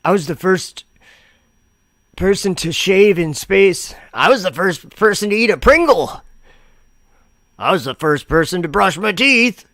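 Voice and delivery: high, sarcastic bragging voice; mock it like a silly brag. Mocking claims about being first.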